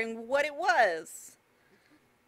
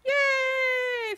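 high happy yay; excited cheer; 14 semitones higher than usual. Real, not acted. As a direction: cheer it out, delighted. Cheer after success.

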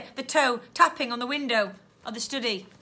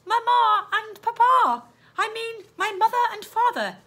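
put-on high girl's voice; high, earnest child reporting; young girl; 11 semitones higher, 5 dB louder than usual. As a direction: pitch it high, like Stella answering. Girl answers the policeman.

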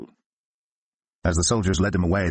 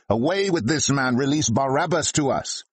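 slow, higher read-aloud mob cry; crowd shouting; 8 semitones higher than usual. A narrator's reading. Crowd demanding Barabbas from Pilate, slow lifted mock shout. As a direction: call it out as the crowd, lifted but not yelled. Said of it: "the whole crowd shouted".

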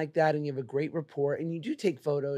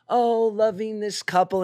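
slow, raised, louder syrupy read; mock gushing caption; 5 semitones higher, 5 dB louder, slower than usual. Friend parodies someone's Instagram caption, slow mock gushing. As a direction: draw it out like a fake-sweet post.

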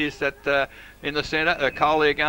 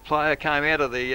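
ordinary delivery

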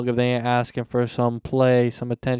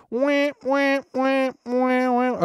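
squeaky, very high mouthed trombone; womp-womp octave higher; 12 semitones higher, much slower than usual. Retold or imitated. Podcaster mocking the kid's out-of-key playing, high-pitched womps. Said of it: "octave higher. He's like".

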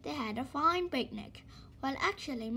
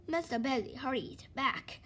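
ordinary delivery, nothing marked

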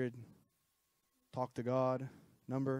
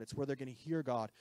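usual speaking voice

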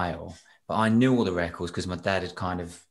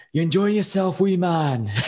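put-on thick Scottish accent, higher and louder; 9 semitones higher, 5 dB louder than usual. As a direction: call out cheerily in broad Scottish accent. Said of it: "He's in this thick Scottish accent".

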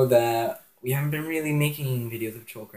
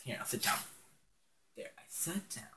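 whispering; 3 semitones higher, 12 dB quieter than usual. Real, not acted. Narrating sitting down, whispered.

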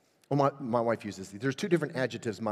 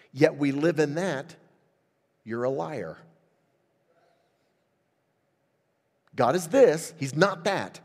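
impassioned preaching; 4 semitones higher, 4 dB louder than usual. Acted. Pastor preaching First John to his church, raised and emphatic. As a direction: drive the scripture home.

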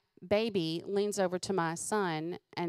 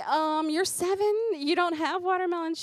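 high, loud incredulous question; shocked question; 10 semitones higher, 4 dB louder than usual. Retold or imitated. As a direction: ask it in stunned disbelief. Mother's shocked question to child.

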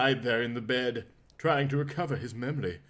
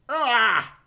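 pained groan; 9 semitones higher, 5 dB louder than usual. Acted. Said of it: "he said".

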